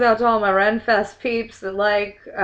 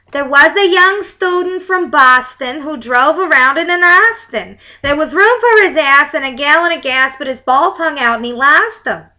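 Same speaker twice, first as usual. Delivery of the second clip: put-on a loose, louder Boston accent; hammed-up Boston accent reading; bad Boston accent; 5 semitones higher, 8 dB louder than usual. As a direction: try a Boston accent and miss. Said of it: "That's a horrible Boston accent".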